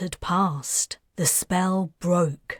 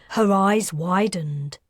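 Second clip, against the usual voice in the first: ordinary delivery, nothing marked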